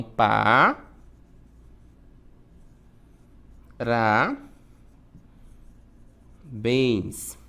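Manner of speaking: parabéns split into pa, ra, bens with long pauses; low, separate syllables, each left hanging. Shown as a demonstration. Teacher dividing parabéns for the class, syllable by syllable.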